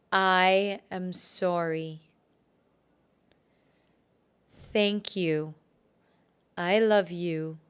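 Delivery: slow, drawn-out affirmations, long pauses; relishing the words. Shown as a demonstration. Healer repeats until it sinks in, relishing each word. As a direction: savour each phrase and let it sink in. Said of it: "you have to relish the words".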